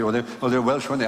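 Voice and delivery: normal talking voice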